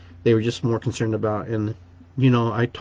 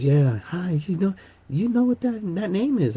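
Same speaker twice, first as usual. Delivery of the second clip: lightly voiced cleaning lady, higher and curious; a hint of the cleaning lady quizzing him, higher pitch; imitating the cleaning lady; 7 semitones higher than usual. Cleaning lady quizzing the patient about her name, lightly voiced higher.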